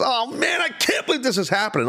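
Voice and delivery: imitating wrestler's dismay. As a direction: play the beaten wrestler about to tap out. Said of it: "like, this".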